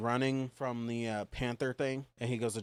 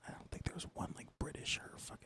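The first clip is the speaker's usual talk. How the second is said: whispering; 5 semitones lower, 14 dB quieter than usual. Real, not acted. Hushed aside about the actors.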